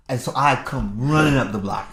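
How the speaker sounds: ordinary delivery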